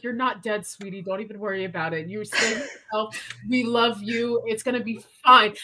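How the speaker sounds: calm your scared friend gently in the middle of the rage; sweet reassurance. Acted. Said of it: "she looks over to Hayden and she's like".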